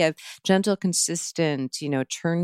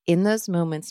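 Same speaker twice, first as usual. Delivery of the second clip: usual speaking voice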